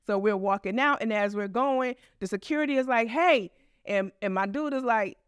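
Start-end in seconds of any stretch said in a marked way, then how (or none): 3.1-3.5 sharp mock call of hey from the guard. Security guard calls after them, a retold sharp hey.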